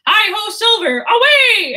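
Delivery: high, loud mock narrator cry; shrill audiobook narrator. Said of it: "It's so shrill".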